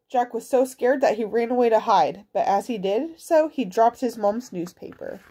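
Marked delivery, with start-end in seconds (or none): none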